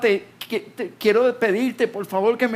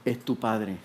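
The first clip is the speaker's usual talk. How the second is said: low, quiet voice of a father; acted grave, hushed phone voice; father's subdued reveal; 9 semitones lower, 7 dB quieter, slower than usual. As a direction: drop low and soft, after a heavy silence. Father speaks after long silence.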